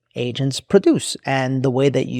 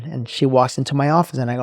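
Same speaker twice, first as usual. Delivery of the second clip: plain reading voice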